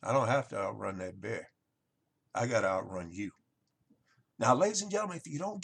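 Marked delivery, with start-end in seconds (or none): 0.0-3.3 low, unhurried deadpan; lightly voiced cool-headed friend, lower; calm, low punchline to a panicking friend. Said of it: "Harry calmly looks over".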